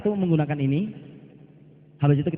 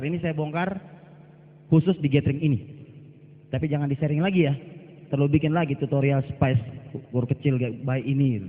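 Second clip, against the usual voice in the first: ordinary talk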